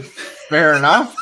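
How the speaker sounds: laughing fair enough; laughing concession. Retold or imitated. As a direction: give in through laughter.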